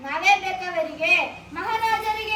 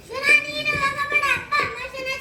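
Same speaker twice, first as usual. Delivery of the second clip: put-on higher, urgent pleading child; tearful pleading; 4 semitones higher than usual. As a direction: beg them not to send him away, near tears. Children beg brother not go. (ಕಣ್ಣೀರಿನ ಬೇಡಿಕೆ)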